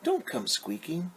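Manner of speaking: slow, high lilting plea, drawn-out line ends; picture-book reading. Acted. Mother pleading with gray mouse, slow high singsong. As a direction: read it singsong, stretching each line. Reads refrain to child.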